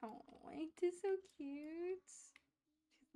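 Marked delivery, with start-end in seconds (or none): 0.0-2.0 cooing. Real, not acted. Gamer cooing at her Sim holding the baby. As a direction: gush over how cute it is.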